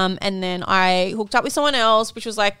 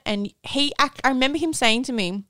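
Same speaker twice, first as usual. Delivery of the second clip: normal talking voice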